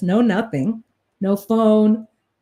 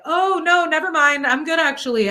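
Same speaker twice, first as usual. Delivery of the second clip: nervous refusal; 7 semitones higher, 5 dB louder than usual. Retold or imitated. Refusing creepy waiter's offer.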